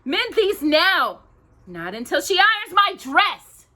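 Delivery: high, loud demanding orders; bossy stepsister. Acted. Stepsisters bossing Cinderella about clothes, demanding.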